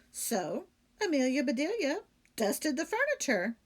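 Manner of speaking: ordinary delivery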